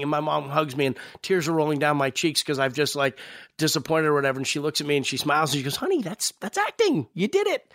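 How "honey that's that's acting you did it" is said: much higher, syrupy proud mother; sweet mom voice. Retold or imitated. Mother comforting her crying son, much higher sugary praise. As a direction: coo it like a beaming mom.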